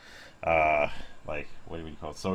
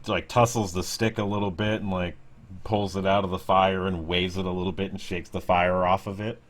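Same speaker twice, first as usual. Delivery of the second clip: ordinary delivery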